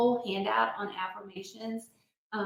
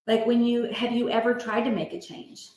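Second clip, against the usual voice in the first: ordinary talk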